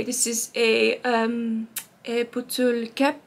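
slow put-on French accent for bottle cap. Shown as a demonstration. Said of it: "Everything sounds better in French".

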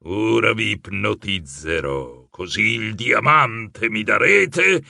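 deep, slow, hypnotic chant; powerful hypnotic voice. A narrator's reading. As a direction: cast a spell with the voice. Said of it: "com'era potente la sua voce". (il mago ipnotizza i protagonisti, voce bassa e lenta)

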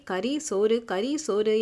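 ordinary delivery, nothing marked

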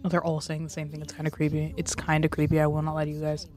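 low, soft deadpan; creeped-out aside. Real, not acted. Streamer confiding to viewers it's creepy, quiet deadpan. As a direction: drop to a quiet low mutter.

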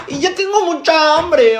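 high, loud hungry whine; whiny complaint.

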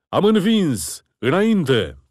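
high, loud triumphant battle cry; victorious roar. A narrator's reading. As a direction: shout the win and push on. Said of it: "răcni Daud".

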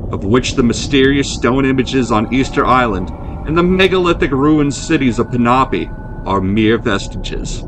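usual speaking voice

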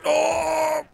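loud sudden cry of shock; startled shout. Real, not acted. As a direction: yelp in surprise. Sudden crash with the horse.

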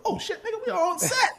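very high exclamation through laughter; laughing mock alarm. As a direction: squeal it in mock panic, cracking up.

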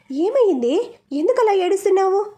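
startled and worried, asking why she cries; anxious asking. A narrator's reading. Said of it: "కంగారుగా అడిగింది లత". (కంగారుగా అడగడం)

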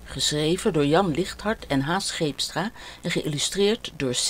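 plain reading voice

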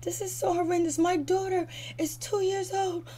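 high, whiny fake distress; mocking a cast member pretending to be upset; mock crying. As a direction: overplay her fake horror. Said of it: "She starts to cry and make up like".

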